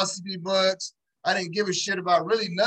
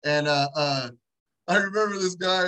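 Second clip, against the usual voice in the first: ordinary delivery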